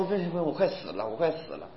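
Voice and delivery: soft, faint mock moaning; mock dying sister. Sister moans she is dying, quietly mocked. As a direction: whisper it like a fake deathbed. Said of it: "给一个姊妹祷告".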